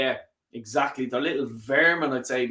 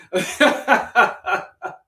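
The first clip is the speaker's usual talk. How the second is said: loud, high burst of laughter; laughing; 9 semitones higher, 4 dB louder than usual. Real, not acted. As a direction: crack up at your own joke. Laughs at own joke.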